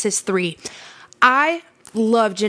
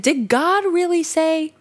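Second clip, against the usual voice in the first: higher, sly, doubting question; insinuating serpent; 7 semitones higher than usual. Shown as a demonstration. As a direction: plant doubt in her mind like a sly serpent. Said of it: "see how he says it like that?".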